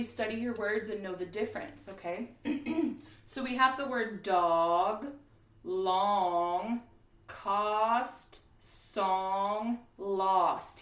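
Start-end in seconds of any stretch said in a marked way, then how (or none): 4.2-10.8 "dog, long, cost, song, lost" with a slow, drawn aw; stretched aw. Shown as a demonstration. Teacher showing spelling words' shared sound, drawn-out. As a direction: lean on the aw vowel slowly. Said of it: "Hear how they all make that same sound".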